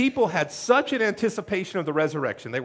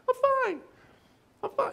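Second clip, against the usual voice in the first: much higher squeaky "I'm fine"; way high lying voice; faster than usual. Retold or imitated. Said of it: "their voice goes way high".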